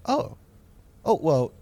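mock-sympathetic, offhand reply; insincere. Teacher to student, hollow fake sympathy. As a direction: fake the concern, hollow and dutiful. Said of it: "the most not sincere".

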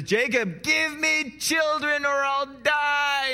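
high, slow, loud wailing plea; mock-dramatic wail, high and drawn out; desperate demand. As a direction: cry it out like a jealous wife at the end of her rope. Rachel's desperate demand quoted.

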